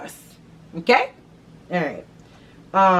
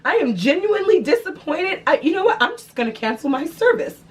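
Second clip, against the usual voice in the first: higher, upset and disappointed customer; sound concerned; 9 semitones higher than usual. Pretend. Threatening to cancel, a higher, upset, disappointed customer. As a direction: play the genuinely hurt customer. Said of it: "You complain and you sound concerned".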